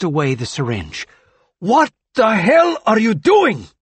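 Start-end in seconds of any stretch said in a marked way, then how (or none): 1.6-3.7 high, slow, furious yell; furious shout. Acted. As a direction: stop him with a roar. Doctor stops the injection.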